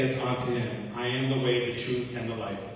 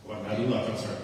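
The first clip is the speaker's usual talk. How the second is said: ordinary delivery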